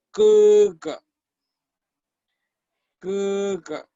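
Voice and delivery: "kuga" with a long high first syllable and short falling second; pronunciation model. Shown as a demonstration. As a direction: hold the first half of "kuga" up, let the second drop. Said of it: "Se pronuncia".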